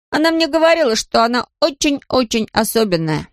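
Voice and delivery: put-on high-pitched child protesting; childlike, high, emphatic insistence; insistent little boy. A narrator's reading. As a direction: argue back like a small boy. Boy Patrick argues back.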